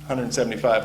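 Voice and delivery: usual speaking voice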